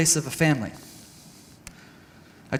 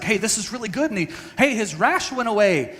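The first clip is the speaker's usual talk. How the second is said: higher-pitched excited retelling; 5 semitones higher than usual. Retold or imitated. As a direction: retell a hopeful discovery with an eager lift. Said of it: "And we get excited".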